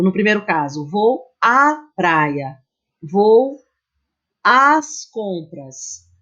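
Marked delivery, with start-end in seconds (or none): 0.9-2.6 "vou à praia" drawn out slowly, leaning on the "à"; slow demo. Shown as a demonstration. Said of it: "Por exemplo". 3.0-6.1 "vou à praia" drawn out slowly, leaning on the "à"; slow demo. Shown as a demonstration. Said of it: "Por exemplo".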